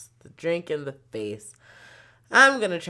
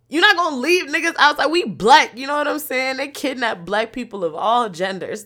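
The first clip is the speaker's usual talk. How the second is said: loud, high outrage; outraged; 6 semitones higher, 7 dB louder than usual. Real, not acted. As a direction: rant at the driver loudly. Heated outrage at driver.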